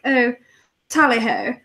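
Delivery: upper-class English toff; put-on Queen's English; proper English accent. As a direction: play a very proper English snob. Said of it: "the proper English".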